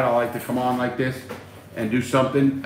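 normal talking voice

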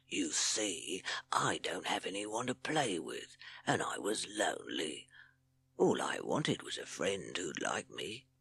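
slow, soft hissing confession; Hissing Sid. A narrator's reading. Lonely snake confessing to his captive, soft slow hiss. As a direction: hiss it softly and haltingly. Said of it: "hissed Sid".

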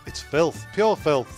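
normal talking voice

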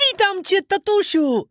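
put-on high, bright boyish puppet voice, calling out; a newly carved puppet greets its dad; cheerful wooden puppet boy. Puppet boy greets his father, calling out in a high boy voice. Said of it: "zawołał".

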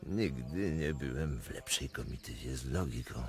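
laugh, then a low, dry reply; amused retort. Acted. Prince laughing off the drunkenness charge, low and dry. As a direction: brush off the jibe with a low laugh.

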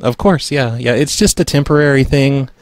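ordinary talk

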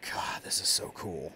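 hushed, amazed whisper; awed whisper. Real, not acted. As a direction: whisper in wonder.

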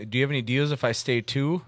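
usual speaking voice

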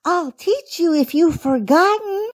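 put-on high, encouraging little-girl voice; kindly rabbit girl. A narrator's reading. Baby Bunty offering to teach skating, high and kindly. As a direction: offer help in a small, high bunny voice. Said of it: "kindly offered Baby Bunty".

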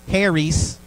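Southern drawl on Harry's, an easy h sound; Southern pronunciation. Shown as a demonstration. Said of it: "Or we say in the South".

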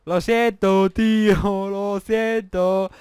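slow, drawn-out fake "lo siento"; mock apology. Shown as a demonstration. Gamer mock-apologizing to the player he knocked off, slow and drawn out. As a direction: stretch the apology out, plainly insincere.

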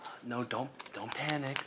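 normal talking voice

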